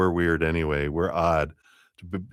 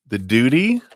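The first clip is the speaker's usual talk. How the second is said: The duty? louder and rising, mock bewildered; puzzled echo; 6 semitones higher, 6 dB louder than usual. Real, not acted. Reader echoes the viewer's question, louder and incredulous. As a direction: throw The duty? back up high as if it's absurd.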